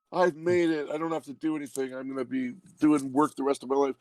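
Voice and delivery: high-pitched, gleeful mock boast; naive triumph. Actor mocking his early RoboCop optimism, naive high-pitched triumph. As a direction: crow it like you're set for life, sending yourself up.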